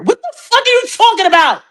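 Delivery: high-pitched incredulous outburst; exasperated outburst. Real, not acted. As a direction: burst out in disbelief. Exasperated at her lame answer.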